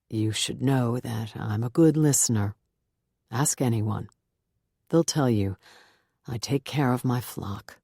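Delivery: low, gentle pastor voice; warm and patient. A narrator's reading. Reverend comforting crying Beth, warm and patient. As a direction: reassure her kindly, take your time. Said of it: "warmly, patiently".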